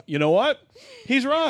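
amused realization, rising high; surprised. Retold or imitated. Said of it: "I'm like".

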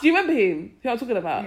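ordinary talk

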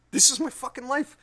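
high, loud, escalated lament; incredulous exasperation. Retold or imitated. Owner repeating the realization about his life, incredulous. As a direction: jump up in pitch and volume in disbelief.

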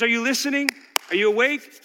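ordinary talk

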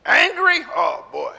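ordinary talk

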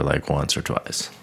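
ordinary talk

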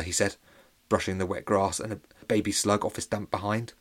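plain reading voice

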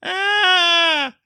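high delighted shriek of joy; excited squeal. Real, not acted. Host squeals after the Deckard Cain clip, ecstatic shriek. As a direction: let out a thrilled, high yelp.

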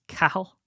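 "Cahill" as CA-hill with short A; "Cahill" squashed toward "cahl"; correct pronunciation. Shown as a demonstration. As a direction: say "Cahill" without any "kay". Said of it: "names that are mispronounced".

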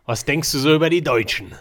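exaggerated harsh German accent. Shown as a demonstration. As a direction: bark it like a Hollywood German. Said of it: "that's not how I would talk German".

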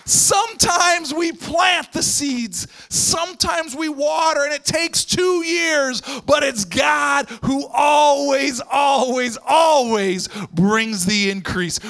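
slow and loud, each phrase punched; emphatic preaching. Acted. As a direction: hammer home always, always. Preached refrain.

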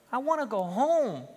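high homesick wail; tearful pleading. Acted. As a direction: wail it, longing for home. Crying lamb repeats his plea.

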